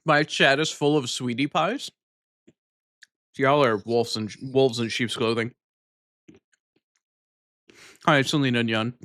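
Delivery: talking through a mouthful of yogurt; mouth full. Real, not acted. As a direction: chew and talk at once. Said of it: "You like it when I talk with my mouth full".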